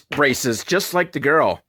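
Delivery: ordinary talk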